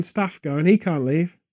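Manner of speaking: usual speaking voice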